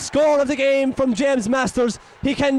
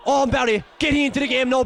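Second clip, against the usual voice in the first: normal talking voice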